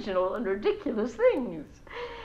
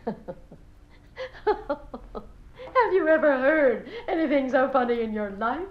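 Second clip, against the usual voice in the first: gushing talk broken by laughter; laughing; 4 semitones higher than usual. Acted. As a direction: laugh through the question. Laughs along at son's prank.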